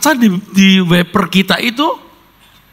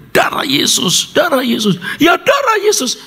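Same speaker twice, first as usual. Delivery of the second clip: starts whispered, climbs to a high shout; terrified prayer; 10 semitones higher than usual. Retold or imitated. Ambon sailor prays on a storm-tossed boat, rising to a high panicked shout.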